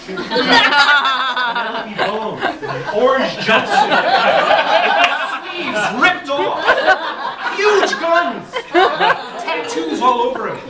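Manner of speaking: giggling through the words; laughing while talking. Real, not acted. Laughs describing the gang boss.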